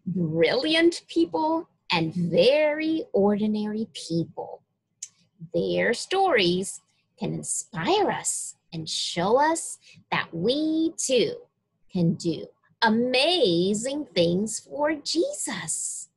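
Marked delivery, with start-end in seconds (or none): none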